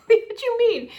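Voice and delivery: high, loud, slow, incredulous; can't believe what you heard; questioning parents who don't want their son back; indignant disbelief. Real, not acted.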